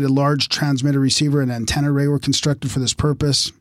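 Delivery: ordinary talk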